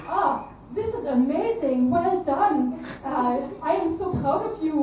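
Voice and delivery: effusive praise in a put-on German accent; bad German accent. Mother's hidden praise translated, gushing German accent. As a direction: gush with hammed-up German vowels, drawn out. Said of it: "sorry about that German accent".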